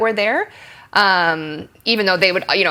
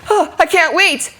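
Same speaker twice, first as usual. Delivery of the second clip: high, gasped eager outburst; mismatched overexcitement; 10 semitones higher, 4 dB louder, slower than usual. Shown as a demonstration. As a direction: overdo the eagerness, ignoring your partner. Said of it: "And then you still go".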